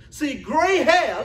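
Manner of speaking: ordinary delivery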